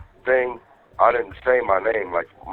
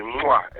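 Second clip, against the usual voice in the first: quick demonstrated "mwah"; re-enacted phone kiss; kiss sound; 3 semitones higher than usual. Husband showing what he chirped earlier, kiss sound. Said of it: "the kiss sound".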